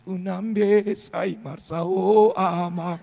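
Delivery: slow, tearful plea in Batak, dragged out; a wife in tears begging her drunk husband, slow; crying wife's plea. Retold or imitated. Pastor retells battered wife crying to husband, slow tearful plea.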